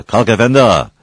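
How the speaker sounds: loud, high wake-up call. Acted. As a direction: call a sleeper to get up. Waking a sleeping man. (uyandırmak için yüksek sesle)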